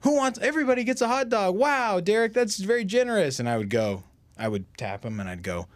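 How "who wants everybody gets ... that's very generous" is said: high, loud announcer boom; imitating the emcee hyping the crowd; MC hype voice. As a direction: play it up like a ring MC. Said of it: "He'd go".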